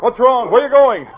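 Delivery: loud, high calls to a dog; calling out. Acted. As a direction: shout after a dog running off. Preston calls after his running dog.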